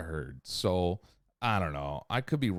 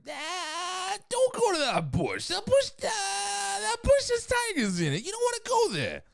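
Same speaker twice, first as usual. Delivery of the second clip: high panicked warning voice. Retold or imitated. Warning voice in a story.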